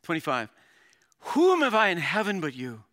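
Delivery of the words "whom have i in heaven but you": loud, high, fervent declaration; passionate. Acted. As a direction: read it out as an impassioned cry to God. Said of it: "Look at that passion".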